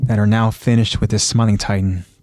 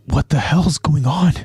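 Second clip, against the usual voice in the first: higher-pitched, bewildered question; confused outcry; 6 semitones higher than usual. A narrator's reading. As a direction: demand an answer in confusion. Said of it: "he's confused of the events".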